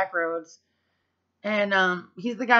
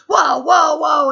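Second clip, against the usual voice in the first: loud, high falling whoa whoa whoa; panicked shout; 8 semitones higher, 12 dB louder, much slower than usual. Retold or imitated. Nervous dad in the passenger seat, loud panicked shout. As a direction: yell like a startled passenger. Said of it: "he wigs out".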